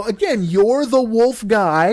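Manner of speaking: slow, high, spelled-out reasoning; exasperated family talking down to George; patient explaining. Acted. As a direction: lay it out slowly like to a child.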